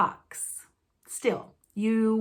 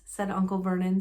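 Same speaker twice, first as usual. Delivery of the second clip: plain reading voice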